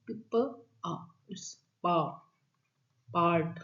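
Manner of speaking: "प, प, आ, पा, पार्ट" built up slowly sound by sound; sounding out part. Shown as a demonstration. Teacher sounds out part for students, slowly. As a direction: stretch the long आ in "पा" then say "पार्ट". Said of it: "ये हमेशा आ की sound देगा".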